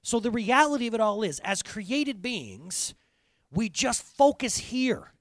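loud, high, fervent preaching; rising sermon fervor; preaching at full force. Acted. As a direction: proclaim it to the back row.

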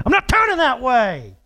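high, re-enacted outburst at a driver; controlled snap. Driver snapping at the honking girl behind him, controlled outburst. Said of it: "I sorta snapped".

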